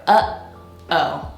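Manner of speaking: "Uh-oh" with the air stopped mid-word; a clear throat catch between "Uh" and "oh"; glottal stop. Shown as a demonstration. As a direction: break it in two at the throat. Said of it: "stopping the air with your throat".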